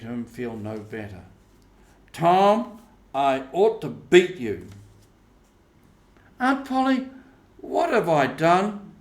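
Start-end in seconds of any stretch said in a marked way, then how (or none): 2.1-4.7 loud, higher cross aunt; scolding aunt. Acted. Aunt Polly to Tom, scolding him, loud. 6.4-8.9 put-on innocent boy asking what he did; pleading boy Tom. Tom to his aunt, protesting innocence, high.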